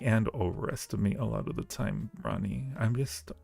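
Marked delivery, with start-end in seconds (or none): none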